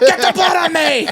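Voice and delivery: very high, full-volume mock scream; frenzied shout. Fan greeting bloody-nosed guy once the song plays, frenzied shout. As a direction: scream it like an overexcited concert fan.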